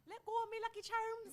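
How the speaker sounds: high, loud mock Irish leprechaun; cartoon Irish accent imitation; bad Irish accent. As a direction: squeal it in a hammed-up Irish accent. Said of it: "I wanted to do his accent".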